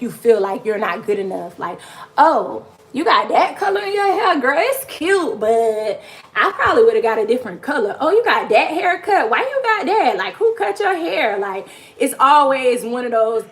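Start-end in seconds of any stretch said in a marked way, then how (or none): 2.2-11.4 high, mocking voice of a critic; high sneering girlfriend imitation; catty critic. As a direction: mimic a catty woman judging your hair.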